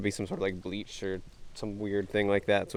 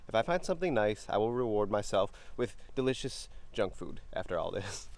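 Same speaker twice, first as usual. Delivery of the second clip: ordinary talk, nothing marked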